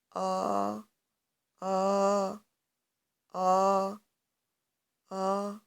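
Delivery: A sung out long with the mouth wide open. Shown as a demonstration. Teacher showing children the open mouth for A. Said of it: "J'ouvre ma bouche".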